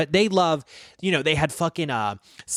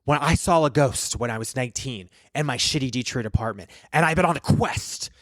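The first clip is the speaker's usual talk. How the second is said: Zach Bagans. Acted. Ghost hunter introducing himself and his quest, solemn host impression. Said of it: "I can do a really good Zach impression".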